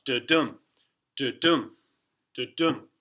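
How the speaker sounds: rhythmic da-DUM, stress on dum; iambic beat da-dum. Shown as a demonstration. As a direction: tap out da-dum like footsteps. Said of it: "with the emphasis being on the second part".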